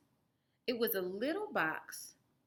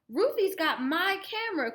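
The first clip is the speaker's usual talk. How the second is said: put-on higher, louder boy's complaint; cried out; 11 semitones higher, 8 dB louder than usual. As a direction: call out to the teacher. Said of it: "cried Martin".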